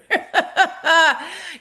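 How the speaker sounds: high, open belly laugh; hearty laugh. Real, not acted. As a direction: laugh out loud, delighted. Delighted by comrade's rallying words.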